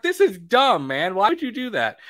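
fed-up replay of yelling at the TV; mad rant. Retold or imitated. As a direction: vent at the screen. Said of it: "I was so mad on my couch".

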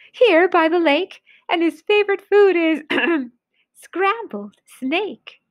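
little mouse, drawing out the last words. Acted.

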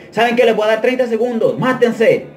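high-pitched announcement of an order; commanding captain. Retold or imitated. Captain giving fighters thirty seconds, commanding. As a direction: lay down the rules like an officer.

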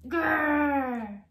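loud hammed-up growl; frustrated growl. The beaten pea growling at the gift of books, loud. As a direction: growl in defeat.

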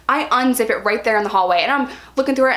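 usual speaking voice

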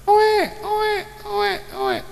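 very high-pitched mock newborn wail; imitated baby cry. Preacher acting a hungry baby crying to God, shrill imitated wailing. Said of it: "sampai nangis".

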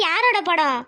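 ordinary delivery

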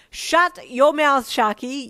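loud, high, drawn-out outburst; sudden blurt. A narrator's reading. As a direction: cut in with a slow, emphatic yell. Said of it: "you suddenly blurted out".

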